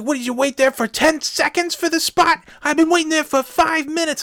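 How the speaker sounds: high, loud mock yell at the other driver; retold screaming match. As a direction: yell it back up high like a furious stranger. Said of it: "we just start screaming at each other".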